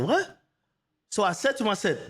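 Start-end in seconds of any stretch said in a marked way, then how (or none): 0.0-0.4 high, rising what; stunned what, rising high; shocked what. Retold or imitated. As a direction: shoot out the disbelief.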